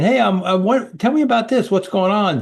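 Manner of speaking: high, breezy curious ask; casual CEO. Retold or imitated. A CEO casually asking staff about ideas. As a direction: toss it off like a chatty boss. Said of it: "the C E O was saying".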